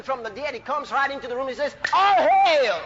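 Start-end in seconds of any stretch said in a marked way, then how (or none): 1.9-2.8 loud, high preacher's hail; shouting master. Acted. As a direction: shout the greeting with full authority. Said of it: "he's a shouting master".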